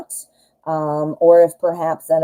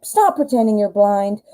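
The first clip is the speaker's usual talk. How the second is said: put-on exasperated kids; 4 semitones higher, 3 dB louder than usual. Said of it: "they would be like".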